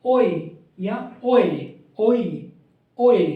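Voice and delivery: drawn out ओए, the o gliding into e; demonstrating the ओए glide for students; oe diphthong sound. Shown as a demonstration. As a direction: sound out ओए clearly, o sliding to e. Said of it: "कैसी sound वाला है?".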